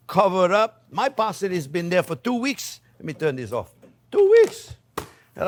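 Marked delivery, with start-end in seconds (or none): 4.1-4.7 much higher, louder, wide-eyed exclaim; amazed. Real, not acted. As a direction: marvel at how long it lasted. Showing off fresh parsley.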